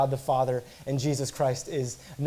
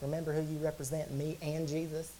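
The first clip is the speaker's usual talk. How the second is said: quick, soft Southern parent voice; 11 dB quieter than usual. Acted. Parent sending kids off on a retreat, mock Southern accent. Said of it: "every parent is Southern in my mind".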